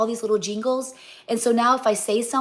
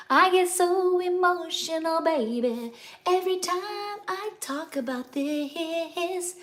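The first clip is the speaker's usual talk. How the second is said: emotional; 7 semitones higher, 3 dB quieter, slower than usual. Real, not acted. Telling viewers how proud she is, getting emotional. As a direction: voice catching with emotion, high and slow. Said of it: "before I get all emotional".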